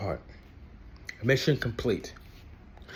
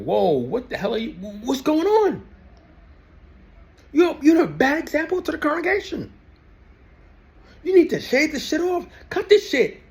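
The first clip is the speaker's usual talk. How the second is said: higher and higher, scandalized mockery; mock scandalized elder; 10 semitones higher than usual. Ex-witness mimics congregation scolding a goatee, much higher mockery. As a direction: play a horrified congregation member.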